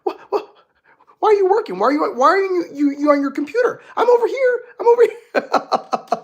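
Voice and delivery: high, loud whiny puppy begging for attention; a needy puppy whining at its busy owner. Pretend. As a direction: plead like a neglected puppy, voice cracking. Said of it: "your puppy or your dog is like".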